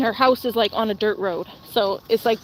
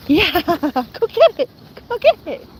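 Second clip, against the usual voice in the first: laughing, then a very high, louder "go get it"; excited pet talk; 7 semitones higher, 5 dB louder, slower than usual. Real, not acted. Urging her husky to fetch, high and excited. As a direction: cheer the dog on to fetch. Throwing rock for dog.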